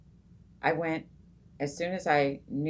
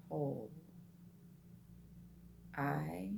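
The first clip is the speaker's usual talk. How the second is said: soft, slow, long pauses between each word; very slow, relaxed mantra; 11 dB quieter, slower than usual. Shown as a demonstration. To meditators, showing the mantra, very slow and soft. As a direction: breathe between words, barely voiced. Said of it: "It's a very slow, relaxed".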